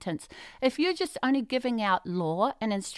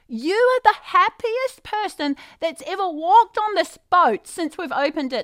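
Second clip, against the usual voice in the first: high, loud called-out praise; retold yell; 6 semitones higher, 7 dB louder than usual. Staff yelling out to the happy passenger. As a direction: call it out across the deck. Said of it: "they were yelling out to me".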